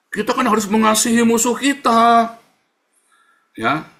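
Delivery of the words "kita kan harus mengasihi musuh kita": high, loud mock-pious proclamation; imitating an objector. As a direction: belt it out as a critic's slogan. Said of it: "yang bilang nih".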